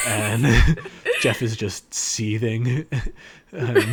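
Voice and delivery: loud laughter breaking through; laughing. Real, not acted. Retelling a scene, laughing out loud. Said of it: "laughing mid-retelling".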